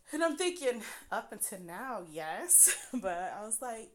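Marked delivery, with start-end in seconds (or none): none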